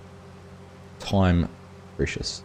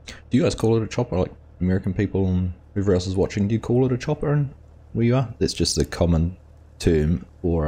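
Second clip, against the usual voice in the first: normal talking voice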